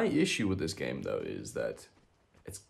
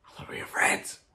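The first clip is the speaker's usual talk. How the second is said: mock hurt whisper; 4 dB louder than usual. Betrayed by another player.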